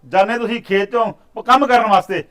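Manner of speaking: very loud, high, building rage; furious scolding. Acted. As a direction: blast the excuses away. Master scolding farmhand.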